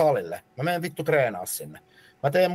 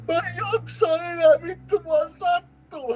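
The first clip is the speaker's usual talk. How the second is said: very high strained whimper; a friend about to break down in pain; whiny near-tears. Retold or imitated. Friend on the leg press whimpers in a very high strained voice. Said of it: "melkein alkaa itkeä".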